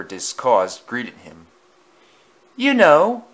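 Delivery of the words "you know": high, loud opening brag; loud boast. A narrator's reading. Engine boasting loudly to his friend. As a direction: boom it out to the shed. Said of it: "boasted loudly".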